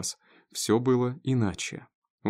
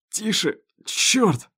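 high, strained cry, louder on the curse; pained outburst; 9 semitones higher, 5 dB louder, much slower than usual. A narrator's reading. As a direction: yelp as the wound is touched. Wounded man cries out during washing. (вскрик от боли)

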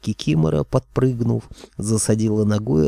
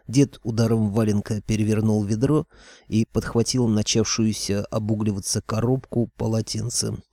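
plain reading voice

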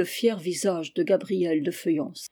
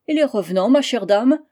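higher and louder, a sudden teasing outburst; sudden cry; 3 semitones higher, 7 dB louder than usual. A narrator's reading. The little man suddenly cries out at the marquise. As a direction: burst out at her with a jab. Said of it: "s'écria-t-il tout à coup".

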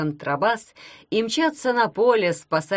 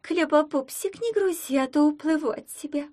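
much higher, capricious mermaid voice; threatening mermaid; 10 semitones higher than usual. A narrator's reading. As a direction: pout and threaten like a spoiled mermaid. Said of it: "пригрозила она".

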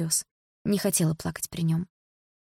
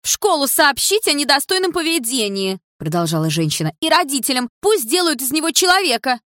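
much higher, louder nagging woman; shrill scold; 9 semitones higher, 5 dB louder than usual. A narrator's reading. A busybody woman threatens to tell the school, high and loud. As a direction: squawk like an outraged busybody.

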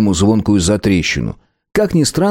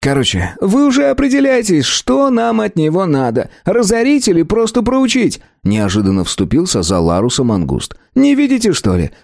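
Mangust's voice; 11 semitones higher than usual. A narrator's reading. Mangust intercedes.

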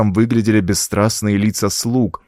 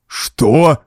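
short, higher outburst; startled cry; 4 semitones higher, 4 dB louder than usual. A narrator's reading. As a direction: cry it out in shock. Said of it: "вырвался крик у графа Генри".

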